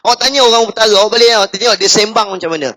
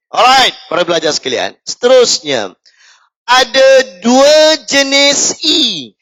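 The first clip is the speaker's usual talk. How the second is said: a touch of a formal teacher's lecture voice; formal lesson voice; 4 semitones higher than usual. Acted. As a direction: lay it out like a stiff classroom lecture. Mock formal teacher announcement.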